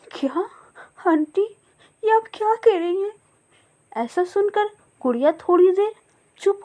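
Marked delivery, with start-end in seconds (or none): 0.1-3.1 much higher, tearful disbelief; a small child who can't believe it; little chick crying out in shock; shocked little girl. Acted. Child hears mother died.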